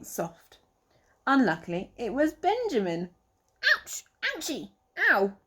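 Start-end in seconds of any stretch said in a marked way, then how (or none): 3.7-5.4 comic pained yelps; squashed rabbit complaining; mock groan. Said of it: "Benjamin groaned".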